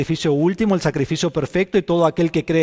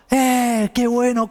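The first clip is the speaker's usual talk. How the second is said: mock joyful cry; 9 semitones higher, 3 dB louder, much slower than usual. Crowd's joyful shout voiced.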